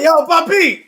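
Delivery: loud, high call-out; retold shout. Player hailing the kid during a vote, loud mock shout. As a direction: holler it high across the lobby.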